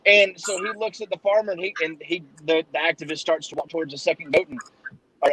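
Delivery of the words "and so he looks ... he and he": usual speaking voice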